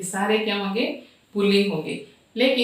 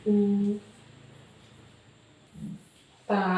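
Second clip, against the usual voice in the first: writing-pace dictation; 6 dB quieter, slower than usual. Real, not acted. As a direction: piece by piece with very long pauses. Dictating while writing on the board.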